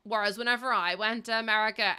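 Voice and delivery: normal talking voice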